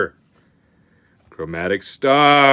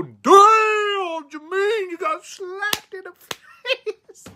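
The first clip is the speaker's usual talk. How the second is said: mock wailing; much slower than usual. Mock pity at a bad pull.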